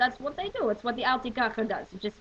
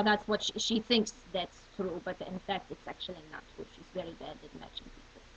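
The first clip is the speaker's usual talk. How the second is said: ordinary talk, nothing marked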